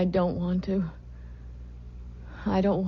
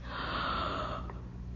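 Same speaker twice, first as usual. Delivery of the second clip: sharp gasp; 10 dB quieter than usual. Acted. Sudden gasp mid-speech.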